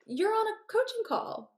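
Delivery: high-pitched, incredulous reminder; mock exasperation. Coach to distracted client on a call, mock exasperated.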